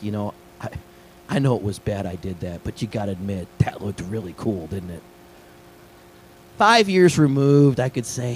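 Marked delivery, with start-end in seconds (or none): 0.0-5.0 low, softer sheepish admission; confiding kid, imitated. Kid confessing years later to his youth pastor, low and sheepish. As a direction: lightly voice the kid owning up quietly. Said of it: "the kid came up to me, he's like".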